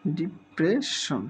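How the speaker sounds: Depression said slowly and carefully; teacher sounding out depression; slowly sounded out. Shown as a demonstration. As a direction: take depression slowly, syllable by syllable. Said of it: "syllable ভেঙে ভেঙে".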